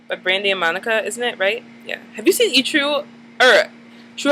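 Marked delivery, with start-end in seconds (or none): none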